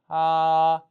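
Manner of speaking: 아 held high and level; first tone. Shown as a demonstration. Teacher demonstrating the first tone of 아, high and level. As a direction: sing out 아 on one high steady pitch. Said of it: "한 번 더 일성".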